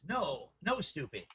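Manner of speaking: sharp muttered scold. Real, not acted. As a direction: scold under your breath. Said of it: "Listen to him, like, scold".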